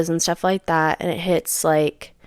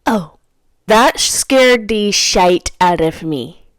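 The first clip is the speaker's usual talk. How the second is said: usual speaking voice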